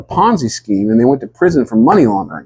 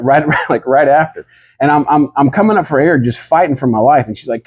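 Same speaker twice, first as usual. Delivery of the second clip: ordinary talk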